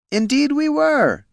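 high, slow, smug villain voice. Acted. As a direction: savour the betrayal. Villain reveals betrayal.

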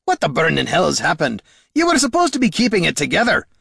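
rising, high-pitched angry outburst; angry berating. A narrator's reading. Dwarf berating the ranger who should keep it together, angry. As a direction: berate her, building in pitch.

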